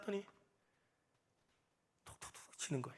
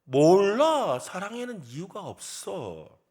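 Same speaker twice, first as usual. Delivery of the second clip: acted young lover; 3 semitones higher, 10 dB louder, much slower than usual. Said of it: "젊은 사람들이 그러잖아요".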